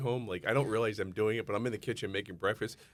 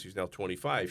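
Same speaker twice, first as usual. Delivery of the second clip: ordinary delivery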